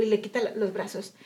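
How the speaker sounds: usual speaking voice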